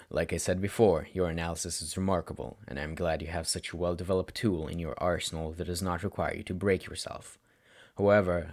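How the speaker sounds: a weary mentor laying it out calmly; stern tired teacher. Acted. Teacher Shota speaks to student.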